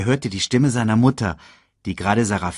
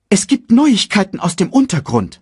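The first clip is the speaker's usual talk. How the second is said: high, loud urgent announcement; bird secretary's report; 12 semitones higher, 8 dB louder than usual. Acted. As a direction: call out the news to your master. Sasu urgently reports to the king.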